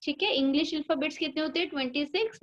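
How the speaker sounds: usual speaking voice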